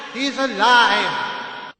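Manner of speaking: joyful cry again. A narrator's reading. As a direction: let the relief pour out. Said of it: "he cried".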